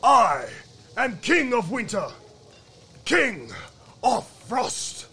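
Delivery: loud, imperious winter king; booming royal self-announcement; snapping frost king. A narrator's reading. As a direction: proclaim it like a mighty monarch. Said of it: "he snapped out".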